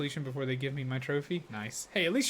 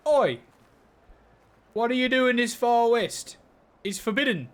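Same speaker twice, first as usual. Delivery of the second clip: raised, loud Aussie call; more Australian accent; 8 semitones higher, 6 dB louder than usual. Shown as a demonstration. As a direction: have another go at a broader Australian accent. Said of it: "More Australian, but perfection".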